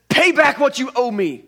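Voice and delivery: loud, high furious demand; angry demand. Acted. Servant choking his fellow servant, furious shout. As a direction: bark it at the man you're choking. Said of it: "he demanded".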